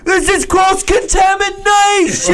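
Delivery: very high, loud, drawn-out outrage; mock horrified shout. Podcaster as the TV chef condemning a kitchen, mock horrified shout. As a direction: stretch the accusation in a hammed-up chef's rage.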